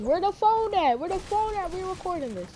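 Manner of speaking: high, loud, excited friend. Retold or imitated. Friend urging classmates to record the fight, excited and high. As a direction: hype it up, reaching for the phone. Friend hyped during argument.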